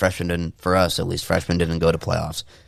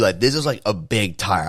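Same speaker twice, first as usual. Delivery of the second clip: ordinary talk, nothing marked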